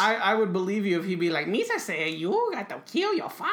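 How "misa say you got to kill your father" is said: high, put-on goofy alien voice; Jar Jar voice. Mocking Jar Jar telling Anakin to kill his father, high put-on voice. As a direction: squeak it out like a clumsy sidekick.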